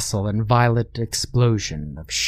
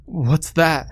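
bubbling; 6 semitones higher than usual. A narrator's reading. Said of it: "Ryan bubbled from below".

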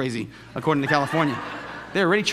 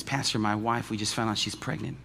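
hushed husband; 7 semitones lower, 5 dB quieter than usual. Retold or imitated. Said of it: "he says".

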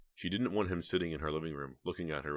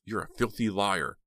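higher, louder furious accusation; angry, gritted teeth; 5 semitones higher, 7 dB louder than usual. A narrator's reading. Heather accuses the man grinning at her, angrily. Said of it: "Heather whispered angrily through gritted teeth".

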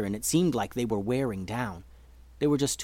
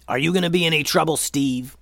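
fast, menacing challenge; gruff warning, pushed a bit louder; growl; 4 semitones higher, 4 dB louder than usual. Acted. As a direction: growl it as a quick threat. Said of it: "growled Momo".

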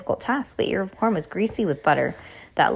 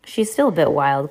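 ordinary delivery

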